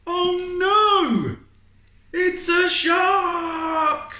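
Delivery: high, loud cry of alarm at a shark; sudden high panicked yell; alarmed shout. Acted.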